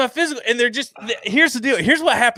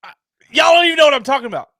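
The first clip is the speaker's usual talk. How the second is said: fast, high and louder, flustered; excited protest; 9 semitones higher, 4 dB louder, much faster than usual. Real, not acted. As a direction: blurt it out, talking over them. Protesting teasing friends.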